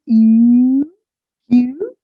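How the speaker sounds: "U, Q" with mouth moving from ee to oo; long, loud French vowel on "U, Q"; French u sound. Shown as a demonstration. Teacher demonstrating mouth movement, French u. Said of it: "the mouth should move towards U".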